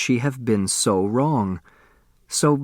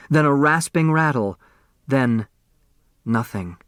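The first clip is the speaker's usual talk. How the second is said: plain reading voice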